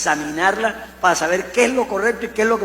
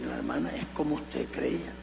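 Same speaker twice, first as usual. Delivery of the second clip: hushed reply; 4 semitones lower, 12 dB quieter than usual. Retold or imitated. Retold reply to a sister.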